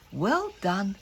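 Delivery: ordinary delivery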